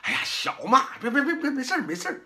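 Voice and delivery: high, fast, fond scolding that brushes it off; indulgent grandparent. Retold or imitated. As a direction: coax and laugh it away like a doting grandma. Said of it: "有的人说还乐呢".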